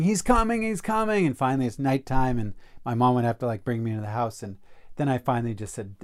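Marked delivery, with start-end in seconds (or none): 0.0-1.3 put-on crying child. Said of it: "and I'd be crying".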